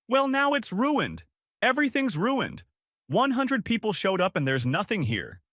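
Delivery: sister shrieking down the phone about her ruined birthday, furious and high; hysterical shriek. Acted. Let the anger climb into a shriek.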